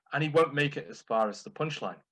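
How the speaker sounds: usual speaking voice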